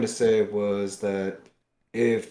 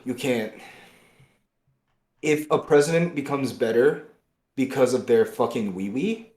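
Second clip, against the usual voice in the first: usual speaking voice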